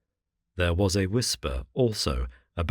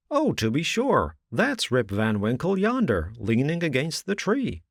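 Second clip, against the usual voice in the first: higher, pointing out someone yonder; lightly voiced villager; 7 semitones higher than usual. A narrator's reading. Villager pointing out young Rip, lightly voiced. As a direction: show him the man by the tree.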